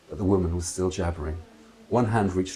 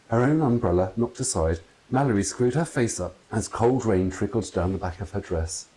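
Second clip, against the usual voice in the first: ordinary delivery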